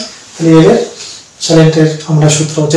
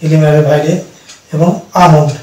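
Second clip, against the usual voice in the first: ordinary talk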